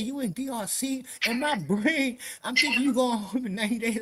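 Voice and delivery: high, loud incredulous questioning; retold loud disbelief. Inmate challenging a man's story about ninety days, loud disbelief. Retelling his alarmed disbelief.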